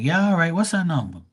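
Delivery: high, cocky calling of the bluff; cocky dare. Pretend. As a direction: sound sure of yourself.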